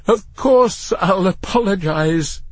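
high, slow, desperate assurance. A narrator's reading. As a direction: promise anything to get out. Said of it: "Matthew was now desperate".

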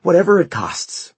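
high, loud, urgent plea; frantic pleading. Acted. Mother promising her son a doctor, frantic.

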